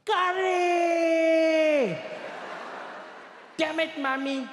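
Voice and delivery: put-on high, very loud little boy's shriek of frustration; childish scream. Little Indian boy screaming at his curry lunchbox, high shrill shout. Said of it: "he'd scream at the top of his lungs".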